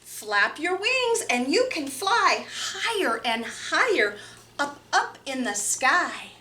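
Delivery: lively picture-book reading with big pitch swings; reading to children. Acted. Librarian reading Hooray for Birds to children, lively and playful. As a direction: read it bouncy and playful for small kids.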